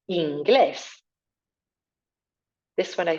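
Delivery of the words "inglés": inglés with the é lifted and stressed. Shown as a demonstration. Teacher repeating inglés after naming its accent. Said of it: "Accent up on the E".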